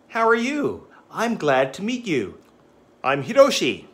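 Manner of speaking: a hint of an eager student; confident boy. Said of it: "confident boy demo".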